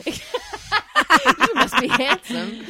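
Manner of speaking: loud, high words through laughter; high, slow laughing delivery; laughing hard. Acted. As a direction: drag the line out slowly while laughing. Joking as imagined child, amid laughter.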